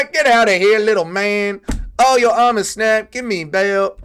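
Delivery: loud, high taunting fighter voice; hammed-up fighter impression, crowing over an opponent; Joshua Van. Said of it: "bro was just doing an N-word accent".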